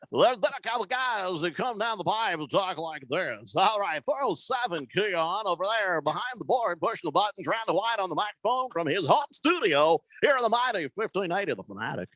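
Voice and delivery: hammed-up sports-radio announcer patter; puker imitation. Host imitating an unnamed radio colleague, puker voice. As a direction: imitate an overblown radio broadcaster throwing to the studio. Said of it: "I'm gonna do an imitation of".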